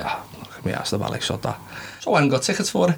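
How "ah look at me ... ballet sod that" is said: a hint of a bored shrug, much softer; dismissive mock mutter. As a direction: pretend not to care, muttered low. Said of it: "I'm like".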